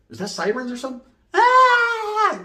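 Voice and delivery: sudden high startled yelp; a hammed-up startled cry; mock alarm. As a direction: jump at the noise with a squeal.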